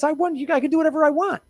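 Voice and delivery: high, fast smug mock voice; mock official, high and quick. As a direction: sneer like an untouchable official. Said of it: "she would be saying shit like this".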